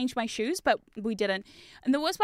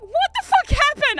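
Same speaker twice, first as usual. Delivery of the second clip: loud; 14 semitones higher, 8 dB louder than usual. Retold or imitated. Others react to her mishap.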